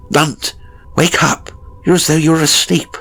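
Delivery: urgent plea to a dazed friend; urgent pleading. Acted. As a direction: rouse him out of his trance. Narrator urging his frightened friend.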